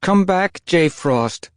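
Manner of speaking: high, loud worried queen calling; anxious call. A narrator's reading. Mother cat calling her kit back, anxious. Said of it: "a queen called anxiously".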